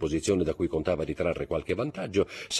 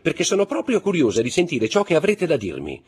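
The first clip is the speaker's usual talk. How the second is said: high, loud indignant challenge; indignant defiance; 8 semitones higher, 7 dB louder than usual. A narrator's reading. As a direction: take him on in front of witnesses, voice pitched high. Baroness defies husband before the guests. (sfida indignata, a voce alta)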